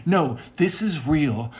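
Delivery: high, loud, impassioned outpouring; fervent preaching rant. Acted. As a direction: proclaim it like a revelation, carried away. Fervent rant at full force.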